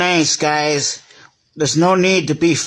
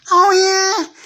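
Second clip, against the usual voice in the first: very high, squeaky "Oh, yeah?" dare; squeaky taunting toy. Pretend. One toy squeaking a dare at the train. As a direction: squeak it out like a cheeky little toy picking a fight. Toys quarrel in pretend play.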